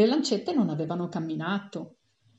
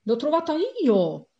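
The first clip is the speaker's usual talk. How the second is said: higher, loud claim of the find; gleeful shout; 8 semitones higher, 5 dB louder than usual. A narrator's reading. Passenger finding a strawberry, gleeful loud claim. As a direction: call out proudly, like a child claiming a prize.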